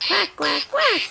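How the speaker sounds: playful mock duck quack for a small child. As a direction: play the mama duck for a toddler, lightly. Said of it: "Mama Duck said".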